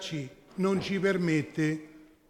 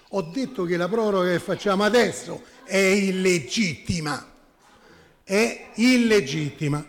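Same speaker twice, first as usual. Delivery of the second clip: emphatic correction; 3 semitones higher, 5 dB louder than usual. Real, not acted. Councillor corrects what was attributed to them, emphatic and insistent. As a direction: drive the point home forcefully. Corrects the assessor's misquote of her.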